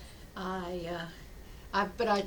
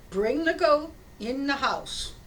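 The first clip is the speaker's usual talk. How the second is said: slow, higher, louder acted insistence; insistent instruction; 4 semitones higher, 5 dB louder than usual. Rabbi asking villager to trust him, insistent instruction. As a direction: spell it out firmly and slowly.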